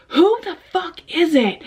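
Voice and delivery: put-on scared girl yelling at a late-night knocker; retold yell. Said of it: "I'm screaming at this person".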